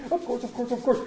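giggle, then repeat yourself merrily; giddy, laughing self-assurance; gleeful giggling. A narrator's reading. Scrooge giggles with glee.

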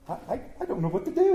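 fast, high, stammering glee; giddy, rushed exclamation; flustered cry. A narrator's reading. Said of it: "he cried".

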